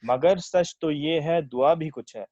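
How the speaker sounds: verse lifted above everyday talk; ghazal recitation. Acted. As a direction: present the couplet to a listening mushaira. Recites own ghazal at mushaira.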